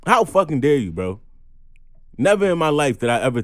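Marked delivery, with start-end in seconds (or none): 0.1-1.2 loud, high, indignant; angry outburst. Real, not acted. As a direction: spit it out in disbelief. Angry rant at a person.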